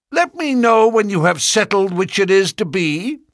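high, loud, mocking farewell; sardonic. A narrator's reading. Ferrier mocking the departing suitors, sardonic and loud. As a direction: sneer them out the door. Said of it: "he said sardonically".

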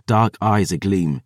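ordinary delivery

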